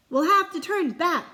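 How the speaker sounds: high, loud call. Acted. Pirate calls out in storm, loud. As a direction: call it across the deck. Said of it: "called another".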